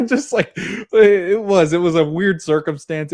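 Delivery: real laugh, high and loud, words drawn out slowly. Real, not acted. Vlogger laughing at the strange first date, high and loud. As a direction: chuckle through the awkwardness.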